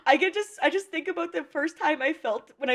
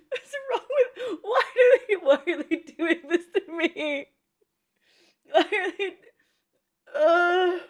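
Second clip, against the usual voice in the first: high-pitched, tearful plea broken by laughs; crying; 7 semitones higher than usual. Real, not acted. Fan protesting what the show does to them, crying. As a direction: sob and laugh while protesting helplessly. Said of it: "so that I can stop crying".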